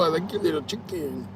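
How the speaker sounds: put-on light touch of Mexican accent; softly mimicked Mexican accent; quiet, casual Mexican accent imitation; Sofia Vergara. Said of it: "the lady sounds just like her".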